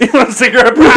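laughing through the line; laughing mock bounty hunter. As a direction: giggle while offering it. Quoting Dog, laughing.

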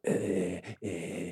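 weak repeated "uh"; exhausted groan. Acted. Storyteller acting himself staggering to his friend's house, exhausted groan. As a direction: drag out feeble little groans. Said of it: "And I'm just like".

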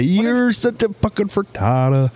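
slow, high put-on voice; mock insult voice. Calling someone a frittata, high mock voice.

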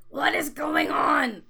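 loud, high angry demand. Acted. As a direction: grab him and demand answers. Grabs him by collar, demanding.

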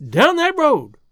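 high, loud cry of fright; frightened cry. A narrator's reading. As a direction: cry out the direction in fear. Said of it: "cried the frightened woman".

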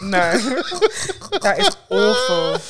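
laughing. Real, not acted. Laughing at crude joke.

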